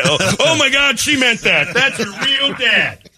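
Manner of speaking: very high-pitched comic disbelief; hammed-up panicked realization, voice way up high; mock shock. Acting out shocked discovery of real father.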